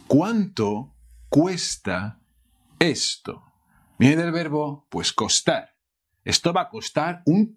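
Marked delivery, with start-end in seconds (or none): 0.1-3.4 "¿Cuánto cuesta" said slowly, each syllable clear; slow model phrase. Shown as a demonstration. Teacher modelling a question slowly for learners. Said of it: "How to ask".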